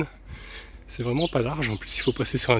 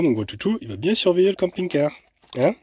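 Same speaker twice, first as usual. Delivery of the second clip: pet talk; 6 semitones higher, 3 dB louder than usual. Real, not acted. Owner teasing her big dog, higher pet talk. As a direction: coo a question at the dog.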